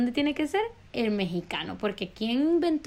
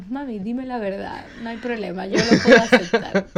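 laughing mock plea; 6 dB louder than usual. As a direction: beg through giggles. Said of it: "me estaba haciendo burla". (súplica fingida entre risas)

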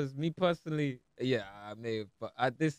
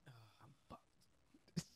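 whispered groan; 24 dB quieter, much slower than usual. Real, not acted. Groans at own mistake.

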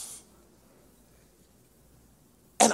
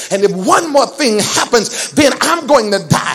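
loud, relentless sermon shout, climbing higher toward the end; full-force preaching; 6 semitones higher, 13 dB louder than usual. Real, not acted. Preacher warns the congregation what panic does to them, full-force preaching. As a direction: drive each phrase home like a fired-up preacher.

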